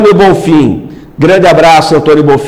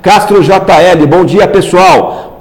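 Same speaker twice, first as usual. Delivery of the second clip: ordinary delivery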